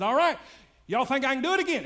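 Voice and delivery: high, loud holler to a crowd; showman calling out. Retold or imitated. Tightrope walker hollering a challenge to the crowd, loud. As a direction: call out boldly from the rope. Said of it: "He said".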